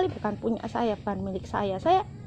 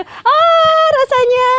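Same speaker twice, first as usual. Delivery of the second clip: much higher, louder gushing sigh of delight; melting exclamation; 7 dB louder, much slower than usual. Real, not acted. Crafter recalling the child's gift, gushing much higher and louder. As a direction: let it burst out, overwhelmed with affection.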